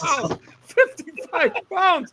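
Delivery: high, drawn-out fake astonishment; mock amazement. Friend teasing the lifter about fifty-five pounds, exaggerated awe. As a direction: gush in slow, high-pitched disbelief, half laughing.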